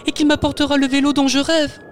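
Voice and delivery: put-on high little-kid voice, wishing; a child dreaming of a bike; hopeful child. Tutu hoping for the bike from Santa, high child voice.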